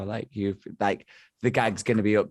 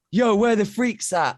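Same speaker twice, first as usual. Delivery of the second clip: high, slow, loud American hype call; imitated American stage call; American accent; 11 semitones higher, 5 dB louder, slower than usual. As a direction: shout it like a US hardcore frontman. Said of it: "obviously in his American accent".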